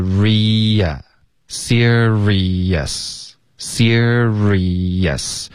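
serious drawn out slowly as SEER-ree-yus; separated syllables. Shown as a demonstration. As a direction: ria as ree-ya, serious in three slow beats. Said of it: "你要添加一个耶的音".

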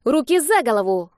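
put-on boy bursting in. A narrator's reading. Sashka bursts into the room unexpectedly, boyish command. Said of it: "Вбежал в комнату Сашка".

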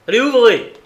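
loud, high commanding shout; shouted order. A narrator's reading. Lestrade demands the locked door be opened, shouting. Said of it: "s'écria Lestrade".

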